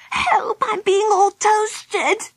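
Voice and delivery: very high, slow wail of a small creature; stretched-out high cry of alarm; panicked little yell. A narrator's reading. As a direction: yell for help in a tiny high voice. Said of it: "yelled Baby Bee".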